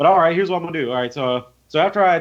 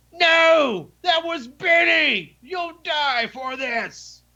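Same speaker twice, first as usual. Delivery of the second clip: grief-stricken enraged shout; 12 semitones higher, much slower than usual. Acted. Bandit inside the shop finding Benny dead, furious slow shout. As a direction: bellow your vengeance over a fallen friend.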